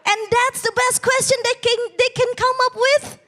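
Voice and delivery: ordinary talk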